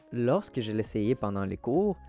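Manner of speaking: ordinary talk, nothing marked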